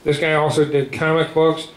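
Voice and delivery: ordinary talk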